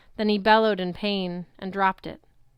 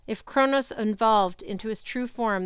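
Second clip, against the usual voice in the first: ordinary delivery, nothing marked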